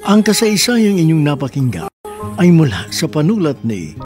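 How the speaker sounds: ordinary delivery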